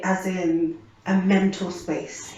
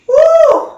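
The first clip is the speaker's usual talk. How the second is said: loud, high "woo"; sharp, soaring exhale of a woo; pained whoop; 15 dB louder than usual. Retold or imitated. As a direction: let out a big high whoop at the pain. Exclaims at how painful it was.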